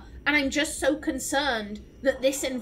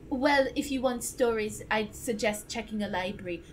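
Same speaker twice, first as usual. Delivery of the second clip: awful imitation of Liliana's accent; 4 semitones lower, 4 dB quieter than usual. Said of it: "awful imitation of Liliana's accent".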